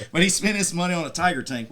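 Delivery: normal talking voice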